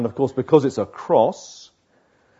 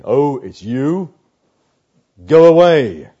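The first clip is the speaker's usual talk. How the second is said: flat "oh it's you", then a louder curt "go away"; dismissive brush-off; 5 dB louder than usual. Pretend. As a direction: shut the door on an unwanted caller. Illustration, shutting out self at door.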